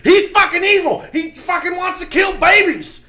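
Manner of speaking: very high, loud mock screaming; hysterical MSNBC shout. Podcaster imitates MSNBC escalating, hammed-up hysterical shout. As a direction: shriek it like an unhinged pundit. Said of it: "and there's M S N B C".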